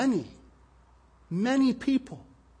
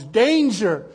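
loud, high shout of danger; shouted rescue call, raised pitch; urgent warning yell; 7 semitones higher, 8 dB louder, slower than usual. Acted. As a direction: yell it like calling to swimmers from the shore. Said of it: "he could yell at the top of his lungs".

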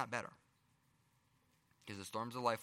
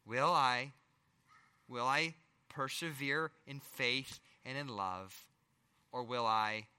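ordinary delivery

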